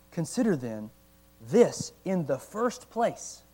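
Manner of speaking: slow, deliberate reading of archaic text; reading slowly. Real, not acted. Pastor reading John Owen to the church, slow and deliberate. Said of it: "I have to read it slow".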